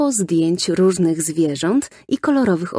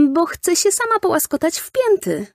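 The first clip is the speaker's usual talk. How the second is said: put-on high, earnest child's voice; explaining little girl; 13 semitones higher than usual. A narrator's reading. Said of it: "wyjaśniła Hania". (wysoki, przejęty głos dziewczynki)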